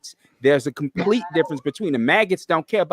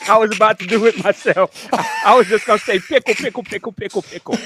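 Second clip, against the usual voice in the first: laughing; 5 semitones higher, 4 dB louder than usual. Real, not acted. Laughing, joking along.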